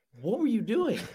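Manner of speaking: shocked dad. Retold or imitated. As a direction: disbelief at the smashed TV. Dad finds smashed TV.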